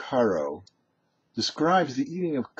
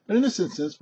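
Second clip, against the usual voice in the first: usual speaking voice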